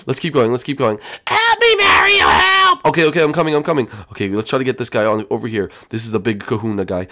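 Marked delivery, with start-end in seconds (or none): 1.3-2.9 shrill high shout for help; high squeaky Toad voice. Acted. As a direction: squeal for rescue, panicked. Captured Toad cries for help.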